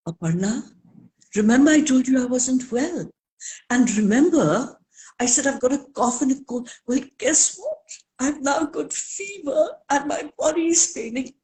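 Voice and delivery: high, pitiful moaning that builds into a near-cry; self-pitying complaint, rising to a teary wail; whiny complaining. Pretend. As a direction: whine about every ache like a martyr. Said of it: "Nitya has two choices".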